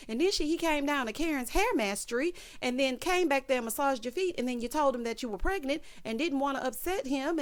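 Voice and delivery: high-pitched rattled-off list of grievances; exasperated run-on rant. Real, not acted. Recapper scolding Karen over how she treats him, exasperated rant. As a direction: pile up the accusations without stopping, pitched high.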